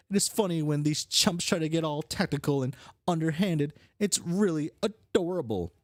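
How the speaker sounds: condescending schemer. Acted. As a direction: belittle the amateurs' plotting. Voices the scheming character.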